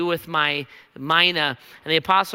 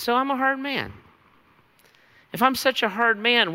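mock agreement, pitched up on hard man; sarcastic; 6 semitones higher than usual. Master mocks the servant's excuse with pitched-up sarcasm. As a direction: throw his words back at him, dripping irony. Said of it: "he's actually sarcastic".

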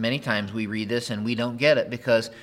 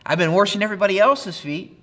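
high, mock-obvious retort; sarcastic reply; 6 semitones higher, 4 dB louder than usual. Preacher voices a wry answer to Peter, mock sarcasm. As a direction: toss it back as if it's obvious. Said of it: "And you're like".

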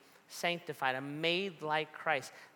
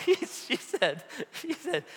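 much higher, giggling through the words; laughing; 13 semitones higher, 4 dB louder than usual. Real, not acted. Pastor laughing while retelling the call, giggling.

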